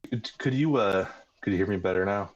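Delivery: ordinary talk, nothing marked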